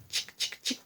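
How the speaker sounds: whispered coaxing call. Acted. George coaxing the chicken toward the spoon, whispered call. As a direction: whisper chick, chick, chick softly, breathy and unvoiced.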